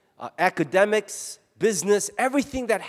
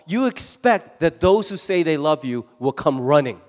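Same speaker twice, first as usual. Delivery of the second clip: usual speaking voice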